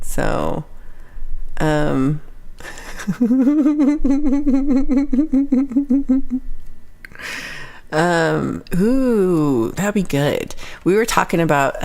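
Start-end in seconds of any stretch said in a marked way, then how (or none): 2.2-7.9 high, sustained giggle; drawn-out high-pitched laughter; long laugh. Real, not acted. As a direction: laugh on and on until the breath runs out.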